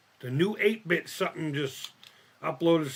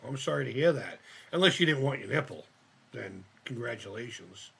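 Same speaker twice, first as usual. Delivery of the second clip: ordinary talk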